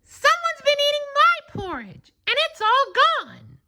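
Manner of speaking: put-on very high, tiny baby bear voice, mildly upset; a little cub whining that his porridge is gone. A narrator's reading. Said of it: "picked up his wee little bowl and cried".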